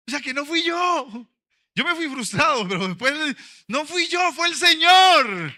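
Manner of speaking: high, loud outburst of praise; fervent preaching. Real, not acted. The pastor tells the church it was the Lord, preaching loud and high. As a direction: proclaim it to the whole church.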